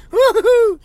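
high, loud "woo-hoo"; whooping cheer; excited whoop. Real, not acted. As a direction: let out a bright high-pitched whoop. Host whoops after his story.

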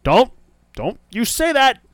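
high-pitched mock warning; alarmed protest. Friend warns Sean off predicting interference, alarmed. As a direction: cut him off, playfully dreading it.

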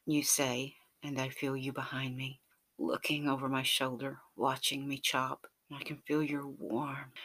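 plain reading voice

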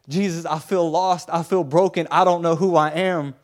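acted lost, broken outpouring; desperate plea. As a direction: plead with Jesus, building in distress. Voicing a lost believer's desperate prayer.

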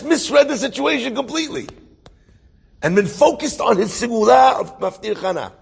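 loud, high-pitched sermon delivery; impassioned preaching. Real, not acted. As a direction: drive the moral home with force.

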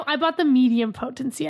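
ordinary talk

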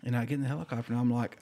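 usual speaking voice

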